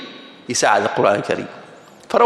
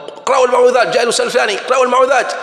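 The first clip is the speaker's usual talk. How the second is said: urgent urging; 8 semitones higher, much faster than usual. Acted. Preacher urging the class to recite, excited and pressing. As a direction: push them to recite now.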